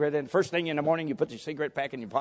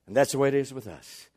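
usual speaking voice

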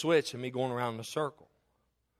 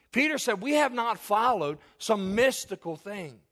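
higher, louder preaching at full fervour; full-force preaching; 8 semitones higher, 6 dB louder than usual. Acted. Pastor warning the church against fables, full-force preaching. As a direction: drive the point home to the congregation.

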